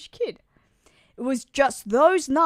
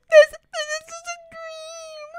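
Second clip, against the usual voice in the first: despairing cry; 12 semitones higher than usual. Acted. Father despairing at son's capture.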